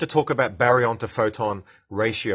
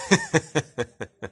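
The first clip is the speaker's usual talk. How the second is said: sustained chuckle, higher than speech; laugh; 5 semitones higher than usual. Real, not acted. Host joking with a disgruntled listener, chuckling. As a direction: let a run of giggles out.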